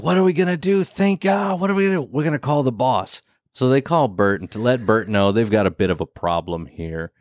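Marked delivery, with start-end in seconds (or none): 0.0-3.2 high, frantic what-do-we-do panic; mock-panicked worker, pitched up. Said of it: "And then he's like".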